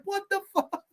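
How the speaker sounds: high, soft, baffled; a squeaky stunned what; high incredulous squeak; mock disbelief. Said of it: "I'm like".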